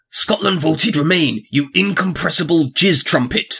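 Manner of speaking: slow Scottish insult, savoured; Scottish accent, each word drawn out. Acted. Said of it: "I'll do it in the accent".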